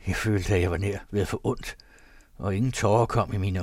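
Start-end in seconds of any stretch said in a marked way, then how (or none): none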